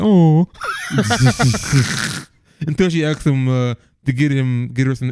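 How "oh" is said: louder drawn-out crowd coo; mock coo with a laugh; imitated audience "aww". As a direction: mimic a whole theater going "ohh". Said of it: "Everyone was like".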